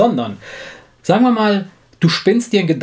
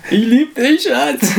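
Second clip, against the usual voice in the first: hammed-up lovey falsetto; mock sweet apology; 10 semitones higher, much slower than usual. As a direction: coo it to win her back. Makes up to wife in falsetto.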